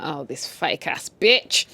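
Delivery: slow, low scornful drawl; scoffing. Retold or imitated. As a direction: sneer it slowly. Scoffing at the fake couple.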